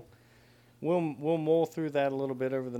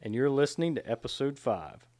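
podcast intro; 3 dB louder than usual. Acted.

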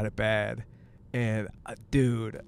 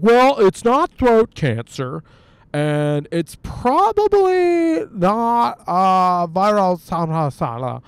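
higher, louder, slow mock doctor voice; 5 semitones higher, 6 dB louder, slower than usual. Mocking doctors who list what it isn't, higher slow voice. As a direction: drag it out like a pompous doctor. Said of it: "They're like".

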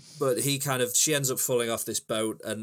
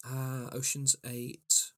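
savouring; 3 semitones higher, 4 dB quieter, much slower than usual. Real, not acted. Savouring a title.

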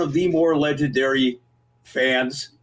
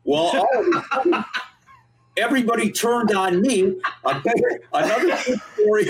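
much higher, laughing through the words; can't stop laughing while talking; 7 semitones higher than usual. Real, not acted. Laughing through his own story.

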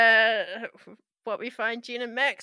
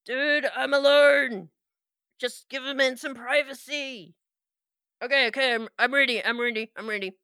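flustered NPC; 6 semitones higher, 6 dB louder than usual. Acted. Embarrassed NPC behind door.

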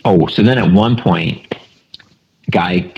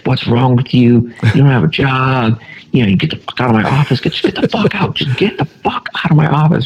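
higher, rising angry boss rant; hammed-up furious boss throwing a stapler; mock meltdown rant; 7 semitones higher than usual. Boss yelling at new hire to get out, imitated rage. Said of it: "goes nuts".

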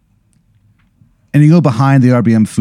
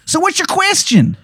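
put-on much higher Baltimore accent; bad Maryland accent; 3 dB quieter than usual. Informant asks the player's question, Maryland accent. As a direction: ask it in a thick Maryland accent. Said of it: "the worst Maryland accent".

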